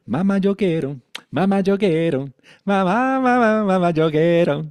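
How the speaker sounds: put-on high, loud begging child; whiny child. Teacher voicing the ego as a child begging mother, loud whine. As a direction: whine like a toddler pulling at mom.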